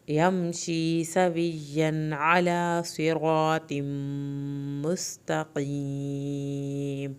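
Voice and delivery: slow, melodic tajweed chant; measured, devout recitation; Quran recitation. Shown as a demonstration. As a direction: chant the verse slowly and fully. Full recitation of passage.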